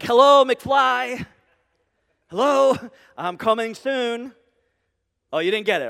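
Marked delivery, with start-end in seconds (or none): 0.0-4.3 loud, drawn-out "Hello, McFly" to someone not listening; loud mock call. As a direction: call it out slowly, as if to a daydreamer. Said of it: "He's like".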